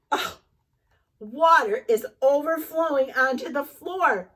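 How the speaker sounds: ordinary delivery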